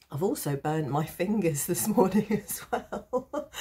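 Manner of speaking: real rueful giggle through the words; laughing at oneself. Real, not acted. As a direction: laugh helplessly through the confession. Laughing at her own mishap.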